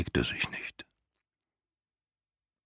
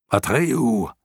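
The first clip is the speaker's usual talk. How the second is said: hoarse, raised cry of a name; hoarse shout; 5 semitones higher than usual. A narrator's reading. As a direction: call out hoarsely across the fire. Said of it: "schrie er mit heiserer Stimme".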